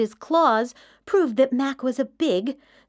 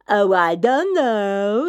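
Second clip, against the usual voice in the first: mock terrifying; 3 semitones lower, 4 dB louder than usual. A narrator's reading. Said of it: "trying to sound terrifying".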